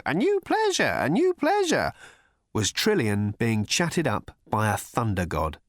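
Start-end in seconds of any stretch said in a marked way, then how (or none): 0.0-1.9 very high squawking creature voice. A narrator's reading. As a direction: squawk it like a pterodactyl in lurex.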